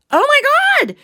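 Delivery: very high, loud amazed cry; amazed gasp. Retold or imitated. Seeing the grown-up puppy, in a high amazed cry. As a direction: squeal in delight.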